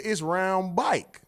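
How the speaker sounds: it's round "bike", "bike" stretched for back; slow it down like he's explaining; slow Jacksonville drawl. Retold or imitated. Jacksonville friend explaining slowly, drawled "bike".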